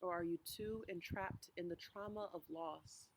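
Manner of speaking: unhurried meditation voice with long pauses; guided meditation. Real, not acted. Pastor walking congregation through a walk to Emmaus, guided meditation. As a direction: guide listeners gently, phrase by phrase, leaving space to breathe.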